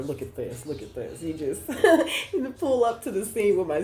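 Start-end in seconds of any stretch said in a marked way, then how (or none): 1.7-2.3 a burst of high, loud delighted laughter. Real, not acted. Reader to viewer, laughing loudly with delight. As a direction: laugh out loud with joy at the card.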